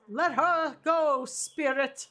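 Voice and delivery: slow, drawn-out command to the spirit; commanding exorcist. Acted. Player commanding the spirit to release Stella.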